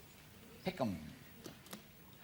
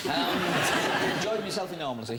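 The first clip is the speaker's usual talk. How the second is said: laughing; 5 dB louder than usual. Real, not acted. Contestant's consolation prize joke.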